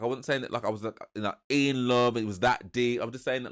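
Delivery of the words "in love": usual speaking voice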